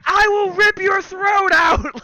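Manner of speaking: slow, high-pitched screamed threat; reenacted intimidating scream; aggressive yelling. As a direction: roar it slowly to scare him off. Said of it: "trying to use aggressive language and yelling".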